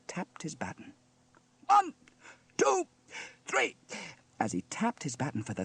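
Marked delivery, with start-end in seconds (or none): 1.7-3.8 very high, loud, separated counts; barked count-in. A narrator's reading. Steele counts in the band, barking. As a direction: bark each number with a gap.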